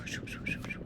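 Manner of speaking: breathy "shoo" brush sounds, voiceless; whispered brush swish. Shown as a demonstration. Painter voicing brush strokes for viewers, whispered swishes. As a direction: mimic the brush strokes with soft shoos. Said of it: "And I'm just going".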